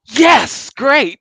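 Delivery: high, loud cheery yes great; mock-thrilled yes, great; mock delighted. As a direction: sell it like happy news. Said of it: "And I'm like".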